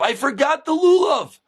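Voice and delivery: high mock shout from a father; comic, high-pitched panicked call; retold scream. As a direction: holler it up to the window. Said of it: "And the father screams up".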